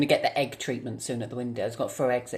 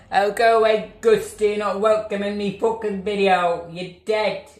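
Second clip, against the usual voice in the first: angry shouting; 5 semitones higher, 7 dB louder than usual. Real, not acted. Yelling at the ghost.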